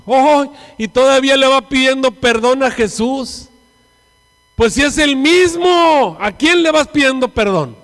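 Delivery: high, loud, stretched-out scolding; loud mocking rebuke. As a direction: jeer at him as an acted accuser. Acting the scolding man in front. (reproche burlón a gritos, muy agudo)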